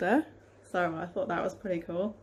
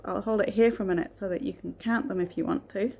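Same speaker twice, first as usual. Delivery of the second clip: ordinary delivery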